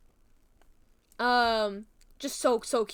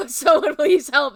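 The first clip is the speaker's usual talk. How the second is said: laughing plea; 3 semitones higher, 10 dB louder than usual. Pretend. Mock plea repeated laughing, louder.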